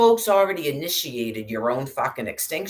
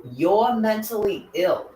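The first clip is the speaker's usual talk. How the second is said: higher, slow sneering mimicry of a troll; mocking critic voice; 6 semitones higher, slower than usual. Mocking online critics, slow high sneer. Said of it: "you just go".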